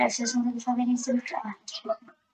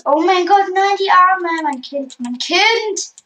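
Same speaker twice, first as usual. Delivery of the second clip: shocked cry; 6 semitones higher, 7 dB louder than usual. Real, not acted. Game character gets hurt.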